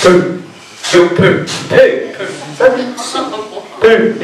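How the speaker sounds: short, high comic "poo" sounds; silly imitation noises; poo bum noise. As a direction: pretend to be a smelly rear end popping. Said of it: "it makes poo poo bum noise".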